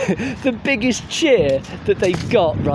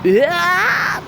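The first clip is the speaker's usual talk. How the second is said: high screamed "Yeah!"; mock metal scream; 11 semitones higher than usual. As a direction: shriek it like a metal vocalist.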